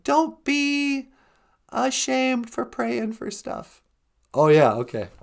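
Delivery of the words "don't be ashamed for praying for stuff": very high, squeaky greeting; high cartoon voice. Greeting the host, squeaky and very high. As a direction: chirp it like a little puppet.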